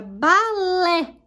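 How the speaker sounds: "balé" slowly in two beats, LÉ stressed. Shown as a demonstration. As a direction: stretch the final LÉ of "balé". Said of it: "o lé é a sílaba tônica". ("balé" devagar, com força no LÉ)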